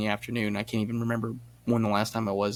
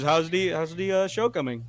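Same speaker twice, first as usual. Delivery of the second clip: put-on higher Indian-accented dad voice; mock Indian accent, raised pitch; imitated Indian accent; 5 semitones higher than usual. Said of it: "he's a little Indian man".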